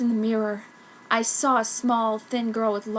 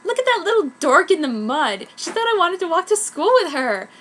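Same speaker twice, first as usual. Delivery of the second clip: put-on mean girl Ichigo; 7 semitones higher, 7 dB louder than usual. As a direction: drag out the greeting sweetly. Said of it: "said Ichigo".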